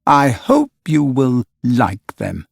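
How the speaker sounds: slow, dark cynical warning; dark and bitter. A narrator's reading. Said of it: "he said darkly".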